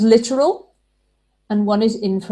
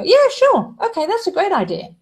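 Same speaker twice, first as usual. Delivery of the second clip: high breezy easy yes; mock cheerful agreement; 11 semitones higher than usual. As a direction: chirp it out happily. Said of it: "her partner might go".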